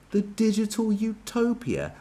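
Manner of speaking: high and slow, mocking disbelief; scornful echo. Real, not acted. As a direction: repeat it like a ridiculous idea. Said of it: "That's what nutters say".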